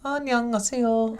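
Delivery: high, fast Korean greeting; Lady Rainicorn voice. Pretend. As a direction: chirp it high and quick. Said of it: "I can do a little bit".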